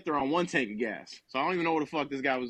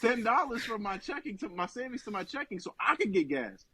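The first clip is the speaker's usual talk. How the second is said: laughing; 6 semitones higher than usual. Real, not acted. Laughing at being broke.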